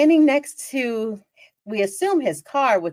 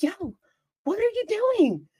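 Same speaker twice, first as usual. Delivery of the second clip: much higher, disbelieving; incredulous call-out; 11 semitones higher, 3 dB quieter than usual. Retold or imitated. Confronting the peeing stranger.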